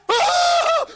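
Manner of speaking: high frightened scream. Acted. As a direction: shriek in fright. Scared cry.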